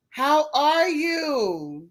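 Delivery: high, slow, drawn-out hello; cooing hello to a favourite viewer; sing-song greeting. Real, not acted. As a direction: stretch each word sweetly up high.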